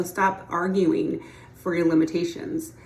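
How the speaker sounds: ordinary delivery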